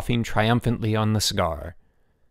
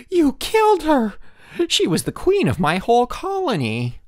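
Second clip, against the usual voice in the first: high loud anguished shriek; 5 dB louder than usual. A narrator's reading. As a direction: shriek it, outraged and grieving. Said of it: "Elmer shrieked".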